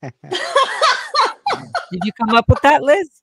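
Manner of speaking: asking while laughing, high. Real, not acted. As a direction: laugh through the question. Laughing at friend's parody.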